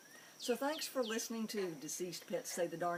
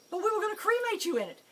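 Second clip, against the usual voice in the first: much higher, louder and fast; high, loud, rushed objection; stunned protest; 10 dB louder, faster than usual. Retold or imitated. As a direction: blurt it out in shock.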